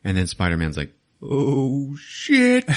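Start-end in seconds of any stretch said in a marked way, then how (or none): 1.2-2.7 high, rising mock alarm; hammed-up dread, getting louder and higher; panicked dismay. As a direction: imitate a kid who knows he's in trouble. Spider-Man's dismay at losing suit.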